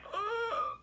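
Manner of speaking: high breathy mock gasp of shock; retold gasp. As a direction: imitate your own shocked intake of breath. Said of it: "And I went".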